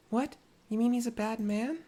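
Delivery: put-on high, small child's voice; little girl voice. Little girl thanking Tepeke, high child voice. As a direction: sound like a grateful little girl.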